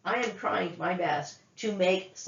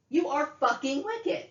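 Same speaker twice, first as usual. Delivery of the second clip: high-pitched, incredulous fury; escalated angry accusation; 8 semitones higher than usual. Real, not acted. Woman accusing her mother, outraged anger. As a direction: let outrage lift the whole voice.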